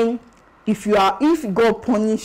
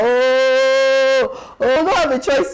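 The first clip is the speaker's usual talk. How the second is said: drawn-out, higher mock groan of dismay; group groan; 4 semitones higher, much slower than usual. Everybody hearing inspection is announced for Saturday, mock collective groan. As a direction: groan it out like a resigned crowd. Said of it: "everybody will be like".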